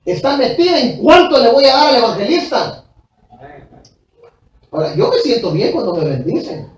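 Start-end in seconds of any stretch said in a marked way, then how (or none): 0.1-2.8 fast, raised, pounding preacher voice; fervent, fast preaching climb; preaching at full force. Acted. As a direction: drive it home from the pulpit.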